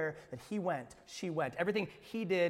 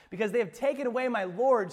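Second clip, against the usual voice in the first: raised, urgent plea of a grieving woman; Mary's plea; 6 semitones higher, 8 dB louder than usual. Acted. Mary answering the angels, urgent and grieving. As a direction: plead through the loss. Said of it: "she replied".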